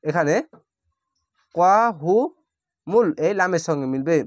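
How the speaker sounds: ordinary delivery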